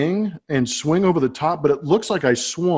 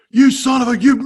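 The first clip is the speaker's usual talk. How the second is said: high, loud, furious outburst; angry yell; 10 semitones higher, 6 dB louder than usual. Retold or imitated. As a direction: blow up like a manager who's lost it. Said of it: "he starts airing out the umpire".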